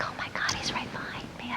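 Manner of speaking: quick, hushed inner thought; urgent whisper. Retold or imitated. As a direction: whisper it fast to yourself. Private thought at the barre.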